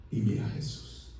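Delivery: sudden drop to a low whisper; very soft, low, whispered narration; hushed reveal. A narrator's reading. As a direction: breathe it out in a whisper, as a revelation. Hushed reveal as Mary sees Jesus.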